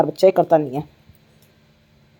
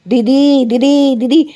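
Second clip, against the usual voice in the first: put-on whiny kid calling; 5 semitones higher, 3 dB louder, much slower than usual. Mother mimics son calling sister.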